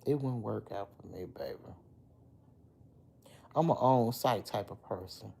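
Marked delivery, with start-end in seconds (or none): none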